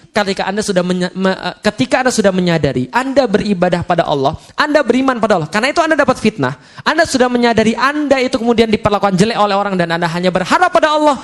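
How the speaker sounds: ordinary talk, nothing marked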